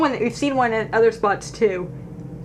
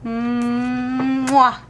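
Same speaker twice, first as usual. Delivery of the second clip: kiss sound; 2 semitones higher than usual. Acted. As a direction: blow a big kiss at it. Kissing the toy bear goodbye.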